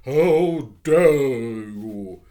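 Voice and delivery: loud, very slow outraged cry; shaking with anger. Acted. Missus Biddle crying out at the children, trembling with rage. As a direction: let fury shake the words. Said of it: "cried Missus Biddle, and her voice shook with angriness".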